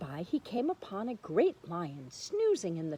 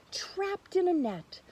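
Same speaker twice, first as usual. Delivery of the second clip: ordinary delivery, nothing marked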